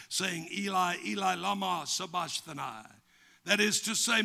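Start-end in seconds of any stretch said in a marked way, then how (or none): none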